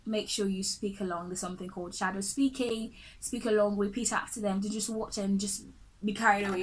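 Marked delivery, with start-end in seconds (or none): none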